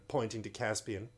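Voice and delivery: ordinary delivery, nothing marked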